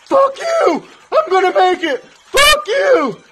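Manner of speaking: very high, full-force shrieking; defiant scream. Acted. Struggling Ernie answering the instructor, defiant high scream. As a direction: scream back, refusing to give up.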